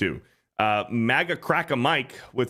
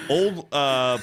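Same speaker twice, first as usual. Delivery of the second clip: ordinary delivery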